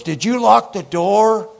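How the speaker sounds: high, loud incredulous question; mock alarm. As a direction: imitate a panicked man shrieking a question. Imitating the alarmed stranger's question.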